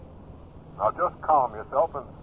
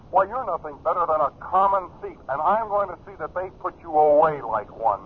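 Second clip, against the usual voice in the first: raised, higher voice, furious at a cheat; angry accusation; 6 semitones higher, 5 dB louder than usual. Acted. Brother-in-law accusing him of stealing trust money, angry and loud. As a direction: let the outrage burst out loud.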